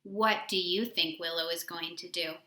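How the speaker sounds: plain reading voice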